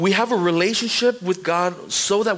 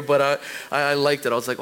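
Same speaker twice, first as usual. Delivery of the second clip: ordinary delivery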